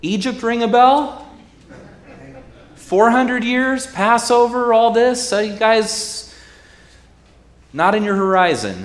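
loud, high sarcastic jab; incredulous mockery. Preacher mocking the crowd, loud and high. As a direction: needle them about forgetting Egypt.

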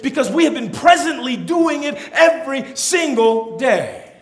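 loud, high-pitched sermon delivery; full-force preaching. Acted. Preacher lifting up the congregation, full-force preaching. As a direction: preach it out emphatically to the whole room.

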